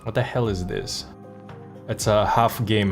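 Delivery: ordinary delivery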